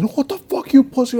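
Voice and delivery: high-pitched retold insult; mock angry taunt. Retelling one fighter insulting the other, agitated.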